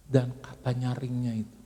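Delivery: normal talking voice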